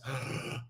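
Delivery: quick, audible unvoiced gasp in; sharp gasp. Shown as a demonstration. Coach demonstrating a bad breath, sharp gasp. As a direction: gasp in through the mouth to show bad breathing.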